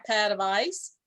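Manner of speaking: usual speaking voice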